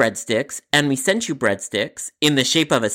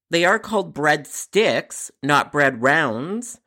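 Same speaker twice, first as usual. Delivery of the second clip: slow patronizing explanation; 2 semitones higher, much slower than usual. Acted. Jared patiently explaining to customer.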